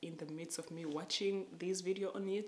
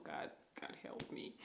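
whispered plea; 4 dB quieter than usual. Retold or imitated. Desperate prayer in dream story.

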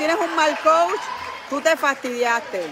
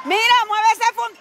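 high, sudden yell at the players; exasperated yell; 8 semitones higher, 4 dB louder than usual. Real, not acted. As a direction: cut loose at them mid-sentence. Yelling at players mid-game. (grito exasperado)

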